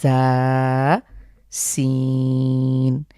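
জা then সিন, held very long; Arabic letters demo. Shown as a demonstration. Teacher comparing জা and সিন for viewers, drawn out. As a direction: sustain both letter names. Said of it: "ঠিক সেখান থেকেই জা".

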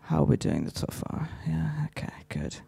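whispered aside to the crowd. Real, not acted. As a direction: check in under your breath.

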